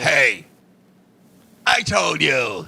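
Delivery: low, slow, drawn-out threat; mock kung fu challenger. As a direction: play a menacing martial-arts film foe. Mimics a menacing kung fu challenger.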